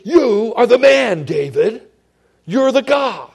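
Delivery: loud, high pointed accusation; a booming prophet's accusation; accusing shout. Shown as a demonstration. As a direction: jab the finger and shout it at him. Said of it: "how did he say it?".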